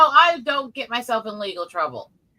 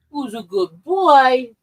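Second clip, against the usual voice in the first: slow, louder coo to the cat; pet talk; 3 semitones higher, 7 dB louder, much slower than usual. Real, not acted. Coaxing her cat Panther on camera, slow, loud coo. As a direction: croon it slowly to the cat, drawing it out.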